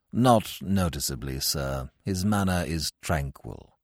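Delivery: low, soft, correct manservant; butler's voice. Acted.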